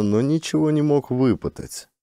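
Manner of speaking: ordinary delivery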